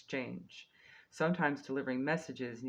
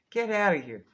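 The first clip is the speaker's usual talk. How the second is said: exasperated outburst; 7 semitones higher, 10 dB louder than usual. Pretend. Exasperated at how expensive things are.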